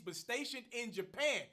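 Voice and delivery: ordinary talk, nothing marked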